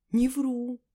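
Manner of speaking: ordinary delivery, nothing marked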